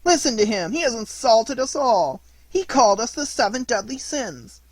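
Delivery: high, loud indignant cry; outraged yell. A narrator's reading. Boys crying out that Pinocchio insulted them, loud and high. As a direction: shout it to the whole gang.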